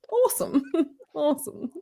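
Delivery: high mock-British echo of "Awesome"; British accent. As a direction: repeat "Awesome" in amused, disdainful English. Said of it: "he did the English accent".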